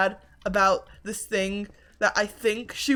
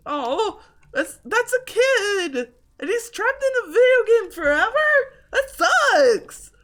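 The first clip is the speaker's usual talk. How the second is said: high, loud, near-crying outrage; sudden high pitched dismay at a trapped kid; distraught outburst; 12 semitones higher, 8 dB louder, slower than usual. Acted. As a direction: wail it like the news breaks your heart. Horrified reaction to haunted child.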